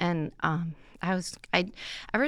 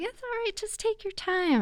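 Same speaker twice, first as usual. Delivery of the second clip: gentle reassurance. Retold or imitated. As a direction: reassure her gently, lifting high. Priest gently reassures crying woman.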